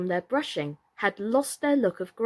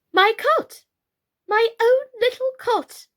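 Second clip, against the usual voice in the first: put-on much higher, loud little boy's voice; small child; 8 dB louder than usual. A narrator's reading. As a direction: squeal out a toddler's wish. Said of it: "wished Apple".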